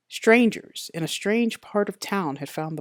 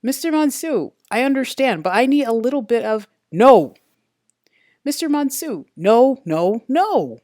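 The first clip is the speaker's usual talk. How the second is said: insistent; 6 semitones higher, 7 dB louder than usual. A narrator's reading. Detective cut off, pressing on.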